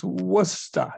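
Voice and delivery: Worcester drawn out slowly as "WUSS-tuh"; local pronunciation. Shown as a demonstration. Speaker repeating the city's name to teach it, local pronunciation. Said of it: "how to pronounce the name".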